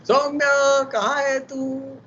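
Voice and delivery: high, loud call for a missing student. Real, not acted. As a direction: shout her name to find her. Calling absent student again.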